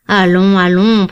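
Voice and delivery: firm, mild remonstrance; protesting. A narrator's reading. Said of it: "protesta Lord Warburton".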